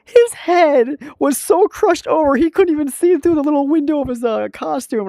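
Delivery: high, loud, amused telling; excited retelling. Real, not acted. Vlogger telling a coworker's T-Rex story, high excited laughter.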